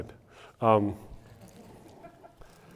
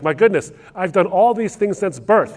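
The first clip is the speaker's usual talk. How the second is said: higher, louder imitation of a self-pleased man; super happy; 8 semitones higher, 7 dB louder than usual. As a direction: act out proud, delighted self-congratulation. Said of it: "the guy's super happy about it".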